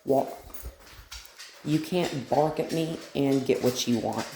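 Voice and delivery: ordinary delivery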